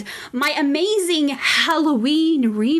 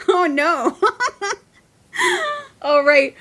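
ordinary delivery

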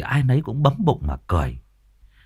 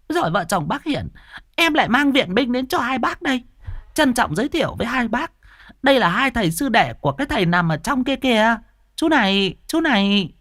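high chattering woman's voice; Bà Diệu mỏng môi. Acted. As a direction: introduce the guests eagerly. (giọng bà Diệu cao, lanh lảnh giới thiệu)

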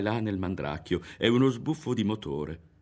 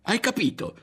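high-pitched shout, flushed with anger; 4 dB louder than usual. Acted. Berto yells at his friend, high and angry. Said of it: "stava gridando Berto".

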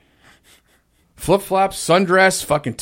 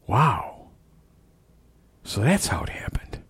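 whispered dawning realization; hushed realization; 5 semitones lower, 12 dB quieter than usual. Retold or imitated. Managers realizing where the money came from, whispered. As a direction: breathe it out as it clicks.

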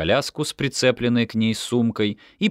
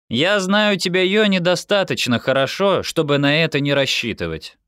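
objecting; 9 semitones higher, 4 dB louder than usual. A narrator's reading. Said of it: "возразила Лерас".

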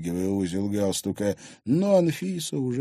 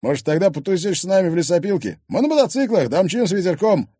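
louder, higher swaggering invitation; brash thug; 8 semitones higher, 6 dB louder than usual. Acted. Thug inviting girl to ride motorbikes, brash. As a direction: invite her out with pushy swagger. Thug's character voice.

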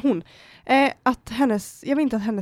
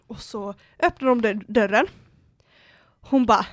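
ordinary talk

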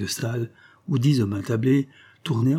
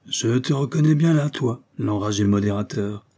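plain reading voice

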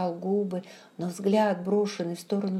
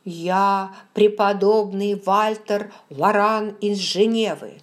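slow, louder, effortful self-introduction; 6 dB louder, slower than usual. A narrator's reading. Frightened pastor introducing himself to the stranger, with effort. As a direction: force each word out, summoning courage. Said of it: "с усилием произнёс".

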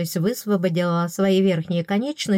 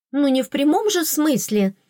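higher, huffy retort; 7 semitones higher than usual. A narrator's reading. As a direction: snort it out in protest. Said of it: "расфыркалась я". (фыркая, выше обычного)

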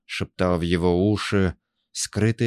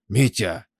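loud, high urgent shout; 4 semitones higher, 4 dB louder than usual. Acted. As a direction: yell to wake him. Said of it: "кричал Валера".

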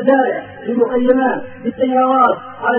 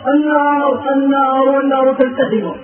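ordinary talk

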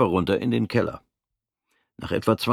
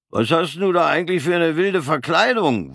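high, sneering soldier's voice; mocking corporal; 9 semitones higher, 3 dB louder than usual. Bald corporal mocks Fritz's odd uniform, high and sneering. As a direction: needle the newcomer from on high.

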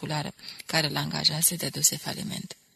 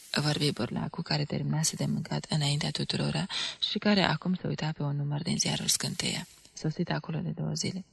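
usual speaking voice

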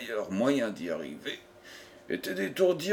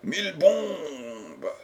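drawn-out, higher-pitched oath; exclaiming; 4 semitones higher, much slower than usual. A narrator's reading. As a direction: cry out, stretching the words slowly. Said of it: "s'écria-t-il". (juron lancé en s'écriant)

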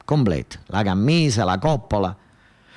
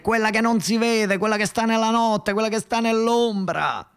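Marietta's urging; 8 semitones higher than usual. Acted. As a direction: climb in pitch as she presses the point, drawing words out. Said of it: "Marietta per telefonino ci diceva ai fratelli".

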